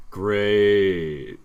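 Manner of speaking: loud, dry sarcastic "Great"; sarcastic buzzer. Pretend. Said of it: "The buzzer is sarcastic".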